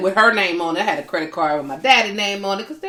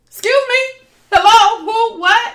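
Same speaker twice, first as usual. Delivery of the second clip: high, loud startled questions; retelling being startled by police, pitched up; 12 semitones higher, 6 dB louder than usual. Retold or imitated. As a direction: caught off guard, what is this.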